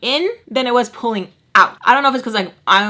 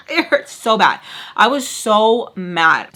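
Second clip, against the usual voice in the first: ordinary delivery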